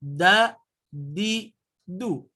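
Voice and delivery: "Da, di, du" with the tongue pressed hard, a firm stop on each. Shown as a demonstration. Teacher drilling the pressed sound, firm and clipped. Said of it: "harus menekan".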